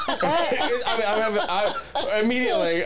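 high giggle through the words; laughing. Real, not acted. Laughing while retelling the scam call to a friend. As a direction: laugh it off, delighted. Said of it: "I'm having fucking fun with this dude".

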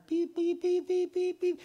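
soft, high beeps in a row; imitating a monitor beep. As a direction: mimic the fetal monitor beeping. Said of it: "you hear the monitor".

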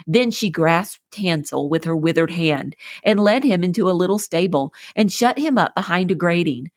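usual speaking voice

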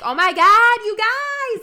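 loud, high-pitched Great Lakes squeal; over-the-top Ohio accent, voice shooting up. Shown as a demonstration. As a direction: exaggerate a Lakes accent, shrieking it. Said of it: "We all kinda talk like this".

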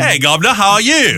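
high-pitched, hammed-up Cockney greeting; Cockney accent. Imitating a British actor's hello, mock Cockney. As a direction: put on a broad mock Cockney. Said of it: "he's got that Cockney accent".

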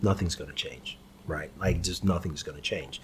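quiet, resigned sigh of a line; lightly voiced deflation; deflated. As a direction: let it sag like you've given up. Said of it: "feels deflated and goes".

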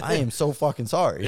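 high, loud exaggerated apology; mock apology. Man retelling how he answered the offended dude, mock apologetic. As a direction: overdo the apology for laughs.

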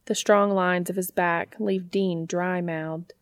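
ordinary delivery

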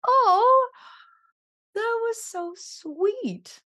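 high, loud gasp then slow, drawn-out gush; touched gush, stretched slowly. Retold or imitated. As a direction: act touched and swoon over the compliment. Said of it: "And I was like".

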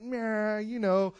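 playful threat ending in a high whoop; mock tough attitude. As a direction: warn them off with swagger. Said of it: "and I'm like".

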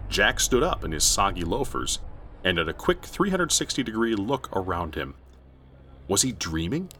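ordinary delivery, nothing marked

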